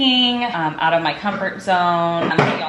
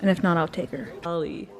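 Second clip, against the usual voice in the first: ordinary talk, nothing marked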